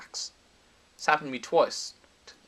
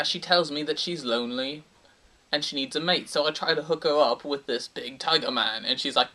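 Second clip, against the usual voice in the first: ordinary talk, nothing marked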